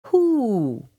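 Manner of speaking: high, loud falling hoot; hooting owl. A narrator's reading. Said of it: "an owl hooted".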